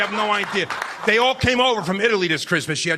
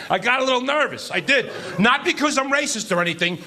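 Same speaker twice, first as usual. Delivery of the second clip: ordinary talk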